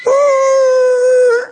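high, startled falsetto ooh; surprised ooh. Retold or imitated. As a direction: gasp it out like you can't believe your eyes. Driver spots preacher on road.

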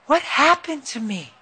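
high, slow voice of the weak wife; imitated wife. Wife asks husband what happened after coma, imitated high slow voice.

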